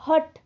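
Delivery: हट said with a clear breathy h at the start; hut with the h. Shown as a demonstration. Teacher to class, showing that हट keeps its h. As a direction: say हट, sounding the h fully, not अट. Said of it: "अट नहीं बोल रहे हम".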